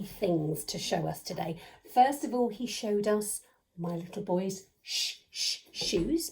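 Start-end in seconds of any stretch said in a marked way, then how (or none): 4.8-6.3 repeated "sh" onset before "shoes"; sounding out sh. Shown as a demonstration. Teacher recapping hidden things, "sh, sh, shoes" sounded out. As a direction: split off "sh" twice, then say "shoes". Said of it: "they begin with a sh".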